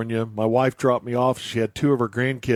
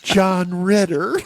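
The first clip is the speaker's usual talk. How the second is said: put-on slow kid voice; 5 semitones higher, much slower than usual. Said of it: "Your grandson said the name John Ritter".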